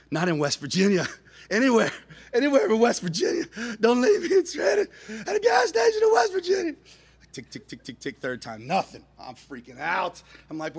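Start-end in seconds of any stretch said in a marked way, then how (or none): 0.1-6.7 building to a high wailing shout; mock-hysterical begging, louder and higher; panicked pleading. 7.3-8.2 quiet, rapid mechanical ticks; small clicking engine imitation; imitated failed-ignition ticking. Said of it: "It goes tick".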